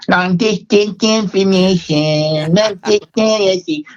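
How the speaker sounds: high, slow nerdy robot-like voice. Retold or imitated. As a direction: do the geeky synth-pop singer, stiff and slow. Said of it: "with, like, this nerdy voice".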